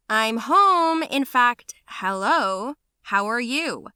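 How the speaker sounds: high, loud sarcastic call; loud pointed greeting. Acted. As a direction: announce yourself pointedly. Daughter arrives ignored, calls out.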